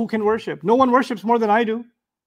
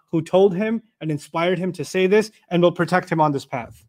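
ordinary delivery

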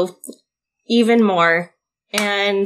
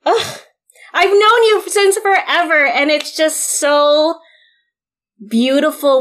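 getting emotional; 6 semitones higher than usual. Real, not acted. Host telling an old friend she's proud, choked up and emotional. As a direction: voice climbing high, close to tears. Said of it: "I'm getting emotional".

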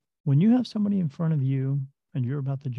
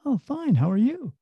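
caught-off-guard reply; 8 semitones higher than usual. Retold or imitated. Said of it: "And they go".

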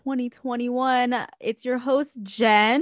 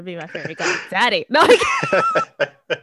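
laughing; 4 dB louder than usual. Real, not acted. Joking about goddaddy, laughing.

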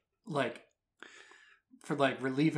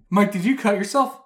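loud anxious calling up; worried girlfriend; 5 semitones higher, 8 dB louder than usual. Retold or imitated. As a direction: call up the stairs fearful. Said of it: "girlfriend calling up worried".

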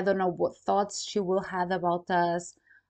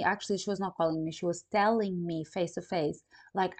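normal talking voice

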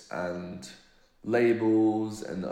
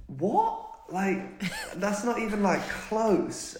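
high-pitched retold disbelief; incredulous, pitched well up; 12 semitones higher, much slower than usual. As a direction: act out being baffled by a wild mistake. Retold disbelief at mistaken identity.

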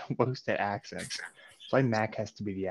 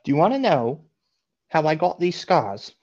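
loud, higher menacing Joker line; Joker quote; 6 semitones higher, 7 dB louder than usual. Acted. To friends, quoting the Joker's scars line, loud and higher. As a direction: lean into the Joker's threat, louder and higher.

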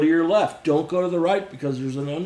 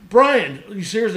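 loud, raised-pitch retold disbelief; incredulous challenge; 5 semitones higher, 10 dB louder than usual. Confronting Brian over drowning girl, incredulous and loud. Confronts friend who won't help.

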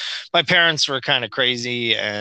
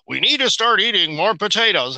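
higher, mock-official voice; mock TV announcement; 8 semitones higher than usual. Mocking experts reversing advice, mock official tone. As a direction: parody the experts' new advice.

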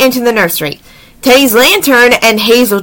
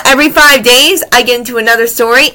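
plain reading voice

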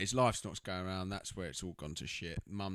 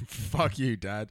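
raised, louder retort to dad; mock angry outburst; 7 dB louder, slower than usual. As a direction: throw it out as a mock-angry son. Acted quarrel between son and dad.

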